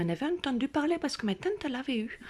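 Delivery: usual speaking voice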